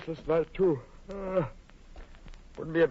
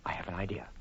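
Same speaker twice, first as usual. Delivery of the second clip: whisper; 10 dB quieter than usual. Acted. Whispers plan.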